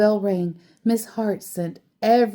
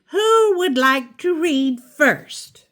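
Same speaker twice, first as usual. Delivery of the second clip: higher, slow teacher's question; 9 semitones higher, 5 dB louder than usual. A narrator's reading. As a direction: ask the class brightly like a teacher. Teacher voiced in read story.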